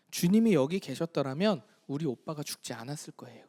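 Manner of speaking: hushed, breathy weeping whisper; whispered through tears. A narrator's reading. Mary weeping to Jesus at the tomb, whispered. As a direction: barely get the words out, crying. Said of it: "그러면서 막 웁니다".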